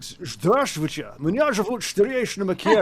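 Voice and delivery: put-on Russian put through a slushy Scottish accent; Sean Connery accent. Frustrated missionary at the door, hammed-up Scottish accent. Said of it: "Russian with a Sean Connery accent".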